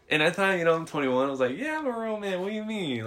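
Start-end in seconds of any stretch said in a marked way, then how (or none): 1.5-3.0 high-pitched, soft protest; hammed-up offended comeback; mock indignant. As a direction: protest it high, as if your manhood were doubted. Acts out his cocky younger reply.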